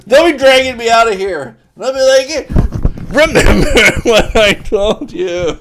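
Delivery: loud, high and laughing through it; cracking up while calling out; loud laughing call as they drag him away. Pretend. Imagining being arrested by FBI.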